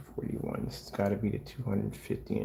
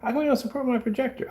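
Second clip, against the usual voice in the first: high, indignant complaint; mad customer; 14 semitones higher, 3 dB louder than usual. Retold or imitated. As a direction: mimic an annoyed customer. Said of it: "I get people get mad at me".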